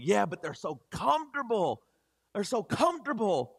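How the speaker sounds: high, emphatic mock whine on comfortable; pleading protest. Defending old shoes to his brother-in-law, pleading protest. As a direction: plead the case, pitching up on comfortable.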